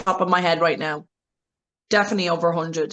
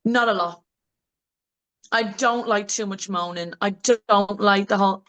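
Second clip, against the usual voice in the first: ordinary talk, nothing marked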